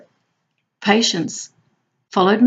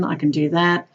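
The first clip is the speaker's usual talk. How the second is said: ordinary delivery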